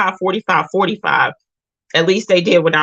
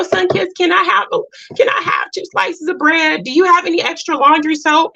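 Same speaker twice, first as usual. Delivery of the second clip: much higher wheedling ask, imitating the other soldiers; mock begging soldiers; 11 semitones higher than usual. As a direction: play the soldiers cadging bread and soap, pitched way up. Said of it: "everybody would be like".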